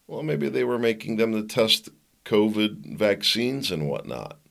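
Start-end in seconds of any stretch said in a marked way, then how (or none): none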